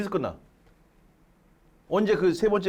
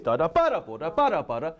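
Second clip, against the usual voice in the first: accented rhythm; 4 semitones lower than usual. Shown as a demonstration. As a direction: sing "빠라" with weight on each accent. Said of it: "So don't go".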